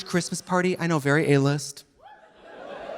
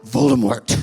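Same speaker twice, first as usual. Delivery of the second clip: Voldemort voice; 6 semitones lower, 4 dB louder than usual. Pretend. Tom Riddle becomes Voldemort.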